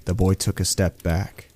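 usual speaking voice

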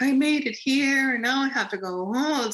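put-on high, loud, childish whine; whining. Complaining to the amazing woman about going home, whining. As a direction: whine like a let-down kid. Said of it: "I am now whining".

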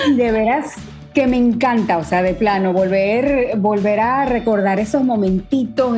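ordinary talk, nothing marked

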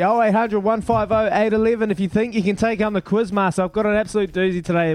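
quick, punchy radio promo patter; radio promo read. Acted. Host pitching the quiz line to listeners, fast promo read. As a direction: rattle it off like a station plug.

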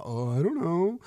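lightly voiced shrugging kid; a mild hint of a bored seven-year-old mumbling it off. Dad imitating all seven-year-olds, a lightly voiced shrugging kid. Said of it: "They go".

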